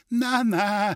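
high, quick-to-object "no, no"; interjected objection. A narrator's reading. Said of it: "fiel dieser ein". (hoher, einfallender Widerspruch)

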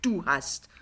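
stuttering, indignant retort; angry. A narrator's reading. Son throws blame back at his mother, angrily. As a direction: stumble over the first words in anger. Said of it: "sagt Pinneberg zornig".

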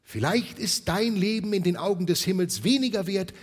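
loud, high stern rebuke. Acted. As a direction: thunder at Scrooge. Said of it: "Der Geist erhob seine Stimme".

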